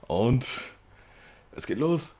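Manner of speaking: high excited call. Real, not acted. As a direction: hype it up, it starts now. Game about to start.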